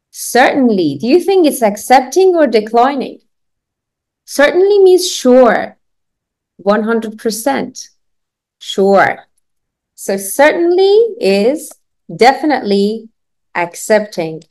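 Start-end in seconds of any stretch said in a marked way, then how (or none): none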